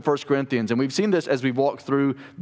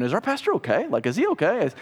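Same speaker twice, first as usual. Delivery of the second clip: mock-concerned churchgoers, high pitched; playful worried questioning, pitch climbing; wondering congregation; 6 semitones higher, faster than usual. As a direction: imitate a puzzled congregation asking after their pastor. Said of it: "you're probably wondering".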